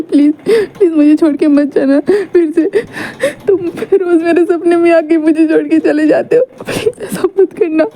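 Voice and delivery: high, tearful begging through sobs; crying plea. Acted. Sick boy begging his love not to leave, sobbing. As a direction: beg him to stay, voice breaking. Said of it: "रो रहा था".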